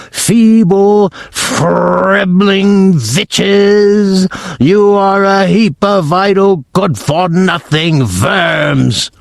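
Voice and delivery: contemptuous shriek at her followers; Grand High Witch yelling. Acted. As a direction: spit the insult out slowly and high. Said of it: "she yelled".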